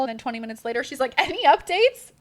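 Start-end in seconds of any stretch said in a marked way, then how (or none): 1.2-2.1 high, loud eager ask; eager question. Retold or imitated. Mom texting for updates, high and eager. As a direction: press for news excitedly.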